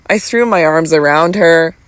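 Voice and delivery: plain reading voice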